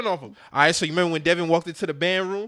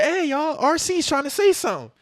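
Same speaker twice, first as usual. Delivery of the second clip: high excited call to the group; rally everyone around; 9 semitones higher than usual. Retold or imitated. Toy alerting the others, high excited call.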